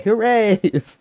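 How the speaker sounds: put-on high, bright toddler cheer on Hoorays; three-year-old voice. Host imitating a toddler cheering the name Hoorays. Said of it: "what a three-year-old would say".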